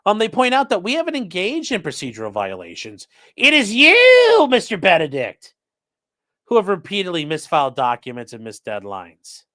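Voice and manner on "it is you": "you" punched high, loud and slow; stress on you. Acted. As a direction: hit the word "you" hard and slow. Emphatic accusation read.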